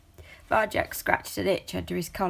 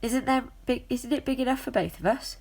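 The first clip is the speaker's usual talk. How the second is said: light and high, pleading; a small cat asking nicely; small young cat voice; 7 semitones higher than usual. A narrator's reading.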